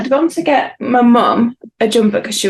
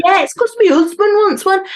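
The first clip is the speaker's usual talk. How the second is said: put-on child voice; 10 semitones higher than usual. Mum repeating her daughter's joke in a high child's voice. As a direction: repeat the toddler's cheeky line.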